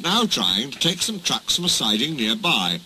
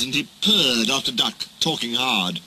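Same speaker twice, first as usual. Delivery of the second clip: plain reading voice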